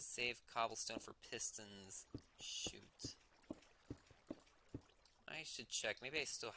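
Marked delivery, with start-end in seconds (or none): 2.4-3.1 whispered. Real, not acted. Mild annoyance under breath.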